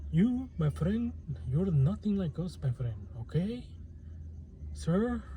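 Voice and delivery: put-on a light Indian accent, rising on you and sir. Scene: telling the viewer a Spaniard might dismiss him, in a light Indian accent. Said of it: "I don't know why I said that in, in the Indian s-, accent".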